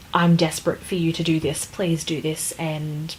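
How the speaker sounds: ordinary talk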